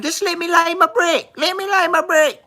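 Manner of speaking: high-pitched mock whine of a coworker; put-on high voice, insistent repetition; mocking imitation. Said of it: "She's going".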